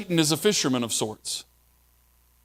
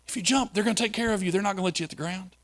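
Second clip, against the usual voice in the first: fast, softer, higher sly reassurance; Satan sweet-talking; 5 semitones higher, 5 dB quieter, much faster than usual. Retold or imitated. Preacher voicing Satan reassuring Jesus, fast and soft. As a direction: rush the soft promise like a tempter. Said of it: "emotional ploy put in by the devil".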